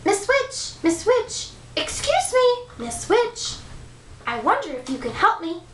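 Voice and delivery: high, polite, timid calling; timid prince voice. Acted. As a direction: call out nervously in a high voice.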